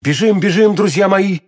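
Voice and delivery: high, alarmed call to friends; rallying exclamation; urgent cry. A narrator's reading. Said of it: "вскричал Д'Артаньян".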